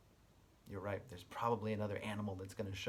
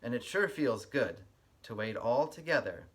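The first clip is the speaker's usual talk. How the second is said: lively storybook read-aloud with a sing-song lilt; reading to children; 6 dB louder than usual. Acted. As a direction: read it like story time for little kids. Reads picture book aloud.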